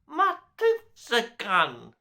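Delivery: put-on high babyish voice; a hint of a toddler babble; gurgling baby. A narrator's reading. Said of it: "he gurgled".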